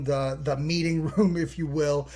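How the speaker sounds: ordinary talk, nothing marked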